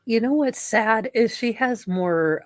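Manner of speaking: ordinary talk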